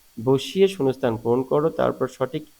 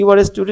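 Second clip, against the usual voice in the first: statement tune, high start on "You are", down on "student"; falling intonation; 7 semitones higher, 6 dB louder, slower than usual. Shown as a demonstration. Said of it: "এটা falling intonation, বা তুমি স্বাভাবিকভাবে পড়লে".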